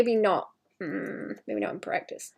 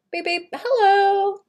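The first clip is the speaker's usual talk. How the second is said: silly high voice; 10 semitones higher, 4 dB louder than usual. Pretend. Greeting cat in silly voice.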